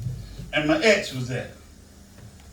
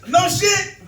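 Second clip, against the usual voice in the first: shocked disbelief; 12 semitones higher, 4 dB louder than usual. Retold or imitated. His shocked reply at gate.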